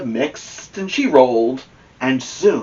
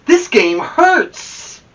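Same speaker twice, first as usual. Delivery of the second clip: slow, higher, louder whine; whiny complaint; 11 semitones higher, 5 dB louder, much slower than usual. Acted. Sore Amelia Bedelia whines to the boys, slow and high. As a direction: drag out the complaint.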